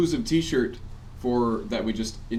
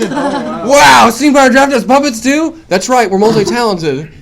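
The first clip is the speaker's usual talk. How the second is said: excited shouting announcer; 11 semitones higher, 15 dB louder, slower than usual. Acted. Announcer-style puppet shout.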